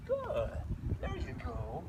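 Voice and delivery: high, soft praise; light praise voice. Real, not acted. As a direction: praise him gently up high. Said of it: "I keep my voice light like this".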